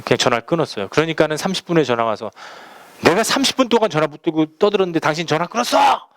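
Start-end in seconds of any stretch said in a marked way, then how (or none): 3.0-6.1 raised-pitch mock outrage, climbing at the end; imitated scolding. Caller berating a pastor for hanging up, imitated angry scolding. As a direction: mimic someone telling you off. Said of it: "막 이러면서 막 혼내는 거예요".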